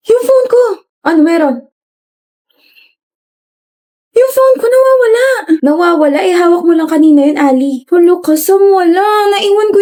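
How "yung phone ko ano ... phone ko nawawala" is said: high panicked cry, pitch jumping up. Acted. Friend panicking to classmates over the lost phone. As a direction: blurt it out in alarm.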